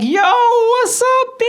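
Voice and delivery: high, slow street greeting, "Yo" stretched out; Antoine's greeting. Retold or imitated. Friend calling out to him on the walk home, stretched-out high greeting. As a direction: hype up the greeting like a cool friend.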